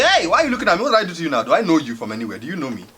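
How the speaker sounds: high, loud mock confrontation; acted outburst, loud at the start; indignant challenge. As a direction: challenge him loudly, then ease off. Imagined confrontation with a staring soldier.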